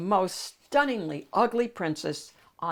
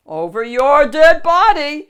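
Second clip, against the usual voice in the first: loud, high, booming echo; gleeful agreement; 8 semitones higher, 15 dB louder than usual. Acted. Shrek agreeing with the knight, loud echo. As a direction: throw the line back loud and eager. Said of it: "Shrek agreed".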